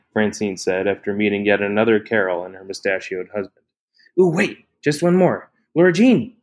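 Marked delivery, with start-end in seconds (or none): 4.2-6.4 higher, louder, calling across the patio; excited calling out. A narrator's reading. Ellie hailing Laura Jean across the party, excited call. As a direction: sing out her name eagerly.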